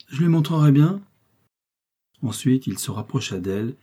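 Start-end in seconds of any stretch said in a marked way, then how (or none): 0.1-1.0 high, loud vow driven home; insistent raised-voice warning; repeated threat. A narrator's reading. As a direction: hammer the threat in, pitched up. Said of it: "tout en répétant".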